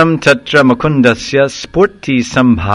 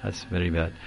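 calm understatement; 5 semitones lower, 15 dB quieter than usual. Retold or imitated. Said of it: "He said".

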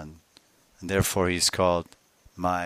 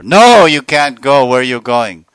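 bar the door and scold her; stern mother-in-law; 5 semitones higher, 18 dB louder than usual. Acted.

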